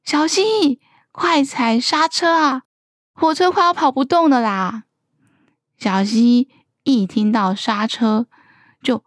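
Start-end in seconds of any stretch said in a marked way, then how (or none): 0.0-4.8 mildly acted hurried cry for help; urgent call. A narrator's reading. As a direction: call ahead urgently, storybook style. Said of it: "连忙喊".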